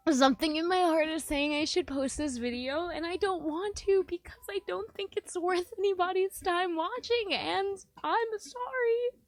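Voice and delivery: high, loud, tearful wail; crying. Real, not acted. Sorry about posting the video, crying. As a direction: talk on through real sobs, pitch climbing. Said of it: "why am I crying".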